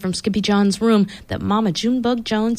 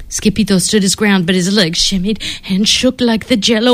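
ordinary delivery

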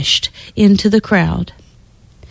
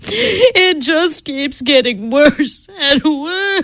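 half-weeping mother's plea; distressed cry; 6 semitones higher, slower than usual. Acted. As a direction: wail it like a worried mother. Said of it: "cried Missus Cream".

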